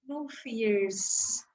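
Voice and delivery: usual speaking voice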